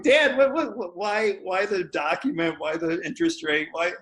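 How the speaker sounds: high-pitched, stammering disbelief, retold; mock-bewildered son questioning his dad; incredulous protest.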